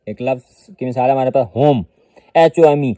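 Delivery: ordinary talk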